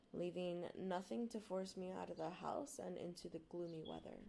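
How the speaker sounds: usual speaking voice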